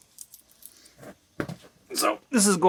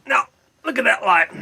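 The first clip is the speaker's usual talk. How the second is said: mock voice; 9 semitones higher, 8 dB louder than usual. Mocking the biker bar light.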